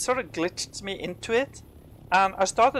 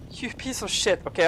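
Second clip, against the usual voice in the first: ordinary delivery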